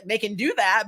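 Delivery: ordinary talk, nothing marked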